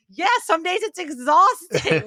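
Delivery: high, loud, drawn-out mock weariness; hammed-up "so exhausting" complaint; retold exasperated agreement. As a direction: play up being worn out by yourself. Retold answer to mom's question.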